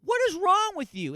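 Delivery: high, loud scolding shout; shouted what is wrong; yelled rebuke. Retold or imitated. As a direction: yell it at her in disbelief. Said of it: "somebody slaps you around and goes".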